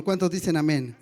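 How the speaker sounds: usual speaking voice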